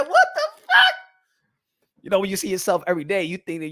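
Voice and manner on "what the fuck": high squeal of laughing shock; mock shocked cry through a laugh; laughing disbelief.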